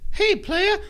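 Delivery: high, loud mimicked greeting; playful put-on high voice. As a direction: call it out high, imitating a rapper. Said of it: "he's like".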